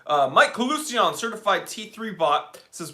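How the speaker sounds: normal talking voice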